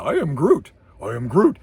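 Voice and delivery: vary the inflection on each repeat; Groot. Retold or imitated.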